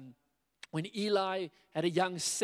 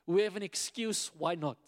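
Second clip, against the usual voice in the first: ordinary talk, nothing marked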